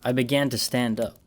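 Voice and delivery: ordinary delivery, nothing marked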